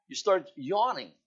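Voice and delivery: normal talking voice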